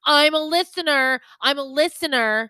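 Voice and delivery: loud, insistent retold fan announcement; eager fan. Eager listener pressing a podcaster's friend, mock-insistent. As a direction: imitate an eager fan, punching it out slowly.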